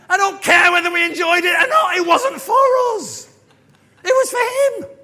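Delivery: very high, full-force shout; exasperated outburst. Acted. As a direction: bellow it in protest at the congregation. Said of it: "I feel like saying".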